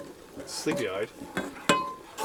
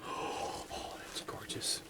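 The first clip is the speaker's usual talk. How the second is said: whisper; 17 dB quieter, much slower than usual. Real, not acted. Gasps at the cooking food.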